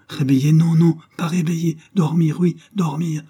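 high, sudden agitated protest; agitated refusal. A narrator's reading. Madwoman refuses to wake sleeping Armand, agitated.

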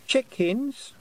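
put-on very high, rising toddler voice; obedient small child. A narrator's reading. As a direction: echo it back as a dutiful little child. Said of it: "said Wentworth obediently".

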